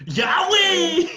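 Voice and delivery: high laughing yell; laughing shout. Acted. Quoting a show, laughing shout.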